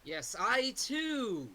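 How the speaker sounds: drawn-out, very slow character voice. Acted. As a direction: stretch every word out slowly. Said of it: "We're all using character voices".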